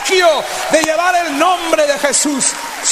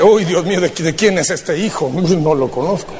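mock embarrassed God; 5 semitones lower than usual. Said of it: "y Dios dice".

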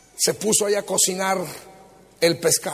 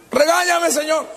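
high, loud begging cry; pleading shout; 8 semitones higher, 4 dB louder than usual. Acted. Peter begs Jesus to scold him, loud pleading.

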